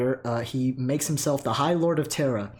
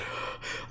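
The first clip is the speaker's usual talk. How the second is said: winded sigh; 10 dB quieter than usual. Real, not acted. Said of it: "I'm out of breath".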